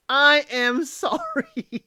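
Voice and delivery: slow, laughing through the word sorry; sheepish laugh in the middle of sorry; laughing apology. Real, not acted. Host apologizing, laughing at herself.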